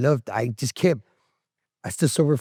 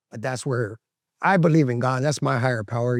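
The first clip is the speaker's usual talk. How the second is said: ordinary talk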